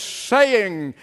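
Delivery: usual speaking voice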